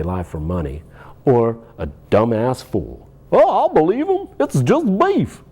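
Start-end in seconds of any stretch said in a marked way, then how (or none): 3.3-5.4 mock Southern accent. Host mocks believers in a put-on Southern accent. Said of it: "do the Southern accent as a sign of stupidity".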